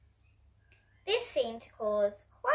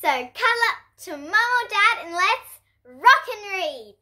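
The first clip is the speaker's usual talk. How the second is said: put-on bright, higher and louder greeting to kids; cheery story-time welcome; 9 semitones higher, 7 dB louder than usual. Host welcoming kids to story time, cheerful. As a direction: invite the kids in like a children's show host. Reading to children intro.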